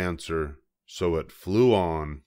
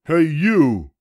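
high, loud, grumpy little bug; a tiny bug spoiling for a fight, high and loud; grouchy ladybug; 10 semitones higher, 9 dB louder than usual. A narrator's reading. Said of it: "said the grouchy ladybug".

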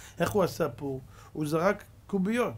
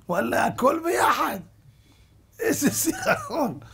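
high, loud, strained wail; mock anguished cry; 11 semitones higher, 5 dB louder, slower than usual. Drenched Haman bemoaning it all at once, high mock wail. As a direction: wail it out in comic misery. Haman reels from filth poured on him.

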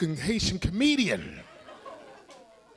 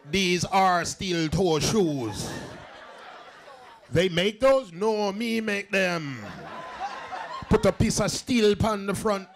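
put-on Haitian accent; slower than usual. Haitian coworker answering about shoes.

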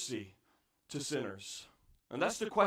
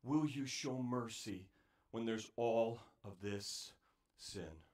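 soft, low, hushed plea; pleading; 10 semitones lower, 10 dB quieter than usual. Acted. Prophet pleading with God, soft hushed plea. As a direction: beg quietly for mercy. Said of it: "a pleading".

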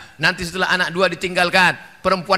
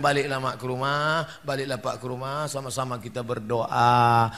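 soft, quiet, gentle counsel; gentle advice; 5 semitones lower, 4 dB quieter than usual. Retold or imitated. Preacher gently sending the parents home to pray. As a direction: send them home softly and kindly.

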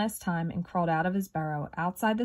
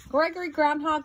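high, singsong call of a skunk; 9 semitones higher than usual. A narrator's reading. Sally Skunk calls to sleeping Gregory, high calling voice. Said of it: "called Sally Skunk".

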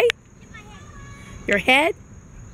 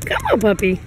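coaxing; 4 semitones higher, faster than usual. Real, not acted. Coaxing baby to climb.

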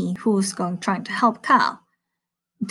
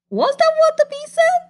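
very high incredulous question; surprised question. Acted. Reader asking children about the mix-up, very high surprised. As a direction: squeak out the doubt.

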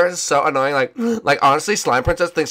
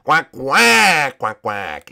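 penguin quacking; drawn-out cartoon penguin quacks; Gunter; 4 dB louder, much slower than usual. Penguin answering the Ice King, quacking. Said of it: "Gunter, what a woman".

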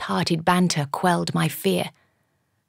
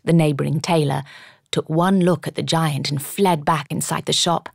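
ordinary delivery

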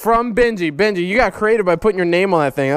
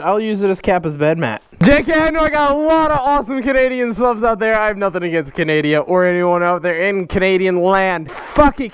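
ordinary talk, nothing marked

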